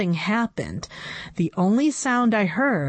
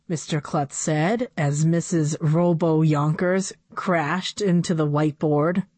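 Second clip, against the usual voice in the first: usual speaking voice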